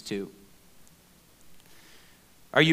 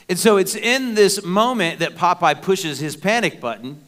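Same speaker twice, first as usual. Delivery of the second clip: louder, driving sermon build; preaching; 4 semitones higher, 6 dB louder than usual. Acted. Pastor building to a climax for the congregation, preaching louder. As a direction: preach it with rising fervor.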